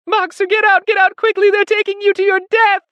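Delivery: very high, loud, panicked warning; terrible screaming. A narrator's reading. Clover warning Boxer in the van, screaming desperately. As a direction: scream to save a life. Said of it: "cried Clover in a terrible voice".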